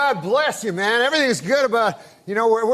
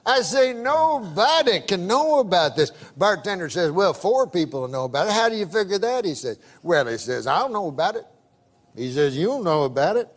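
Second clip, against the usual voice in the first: put-on Southern accent; 2 semitones lower than usual. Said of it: "I can tell by the accent".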